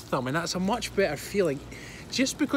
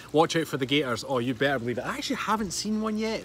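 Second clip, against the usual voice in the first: ordinary talk, nothing marked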